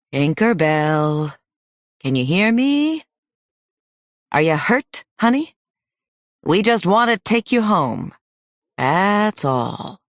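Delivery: drawn-out singsong call, lifting high; gentle calling. A narrator's reading. Hunter calling to hidden girl, gently coaxing. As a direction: coax sweetly, stretching the name out. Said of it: "called in the gentlest voice he could manage".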